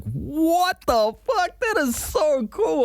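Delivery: very high, drawn-out awe; amazed. Retold or imitated. Retelling their reaction to the Maori god scene, high-pitched amazement. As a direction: squeal it slowly in disbelief.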